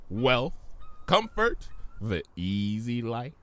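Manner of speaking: slow, coaxing offer; tempting genie. Acted. Discord tempting Fluttershy, slow and coaxing. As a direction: dangle each word like a prize.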